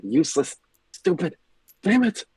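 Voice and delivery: angry muttering. Acted. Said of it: "muttering constant phrases to himself".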